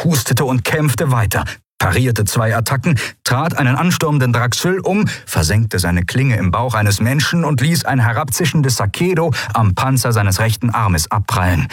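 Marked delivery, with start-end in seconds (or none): none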